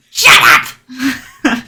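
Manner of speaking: sharp, loud snap; snapping shout. Acted. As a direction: bark it at him. Lizard folk smacks the orc.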